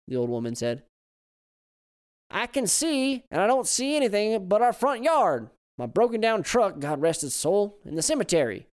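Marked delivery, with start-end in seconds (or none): 2.3-8.7 put-on high, loud grumbling old Southern man. Old man complains to his wife about the locks, loud and high.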